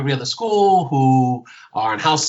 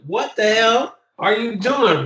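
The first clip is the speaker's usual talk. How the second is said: slow, higher, changed voice, bewildered; exasperated teacher; 10 semitones higher, slower than usual. Retold or imitated. Teacher reacting to his students' schemes, slow, high and bewildered. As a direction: drag it out, baffled at the kids.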